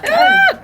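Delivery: sharp high yelp at sour taste; disgusted yelp. Real, not acted. Reacting to sour candy.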